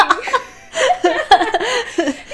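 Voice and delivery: loud laughing fit. Real, not acted. Laughing after quote.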